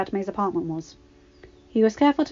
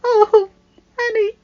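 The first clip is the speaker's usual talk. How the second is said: much higher, louder wailed name; sobbing; 9 dB louder, slower than usual. A narrator's reading. Padme sobs to her husband, high and loud. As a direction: sob his name out. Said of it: "she sobbed".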